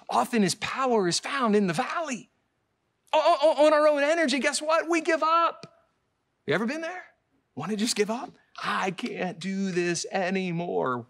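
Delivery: very high and loud, a passionate sermon climax; preaching at full force. Acted. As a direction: build it up, then drop to "But God can".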